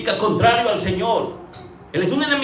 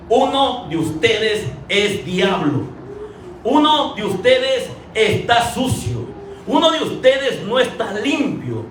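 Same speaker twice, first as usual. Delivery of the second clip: slow, each phrase weighed, "uno" stressed; slow and clear; slower than usual. Retold or imitated. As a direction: lay out every word deliberately like a verdict. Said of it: "lo comunicó con claridad". (lento y claro, marcando "uno")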